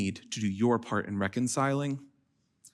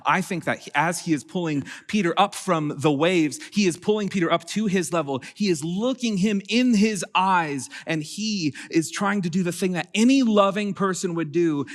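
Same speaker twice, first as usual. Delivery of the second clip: higher, louder preaching voice building with conviction; impassioned preaching; 8 semitones higher, 5 dB louder than usual. Acted. Pastor urging the congregation, impassioned preaching voice. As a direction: lift the room as you preach.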